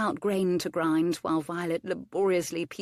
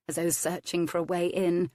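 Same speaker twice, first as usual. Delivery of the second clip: ordinary delivery, nothing marked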